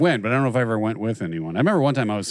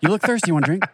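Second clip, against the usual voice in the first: higher, hammed-up pushy offer of a drink; mock awkward suitor; 7 semitones higher, 3 dB louder, faster than usual. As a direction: pester her like a clumsy teen with a water bottle. Mocking guy offering water bottle.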